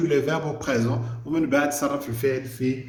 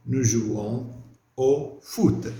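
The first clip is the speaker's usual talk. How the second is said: slow dictation; slower than usual. Shown as a demonstration. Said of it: "dictating answer slowly".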